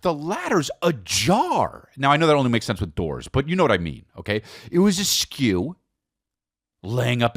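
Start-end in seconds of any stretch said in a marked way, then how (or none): none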